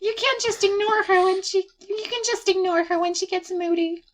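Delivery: high, put-on girl voice; girlish friend, reassuring. Sayori advises friend about moody girl, high and reassuring.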